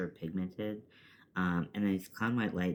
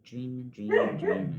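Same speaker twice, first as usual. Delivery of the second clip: sing-song coo, louder and higher; cooing to the dog; 5 semitones higher, 7 dB louder than usual. Real, not acted. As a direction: coo at the sleeping pup.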